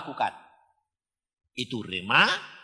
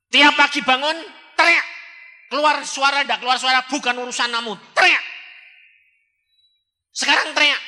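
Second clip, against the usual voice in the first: loud, high commanding shout; 12 semitones higher, 8 dB louder, faster than usual. Acted. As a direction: order her to yell every morning. Commanding her to shout daily. (perintah berteriak keras)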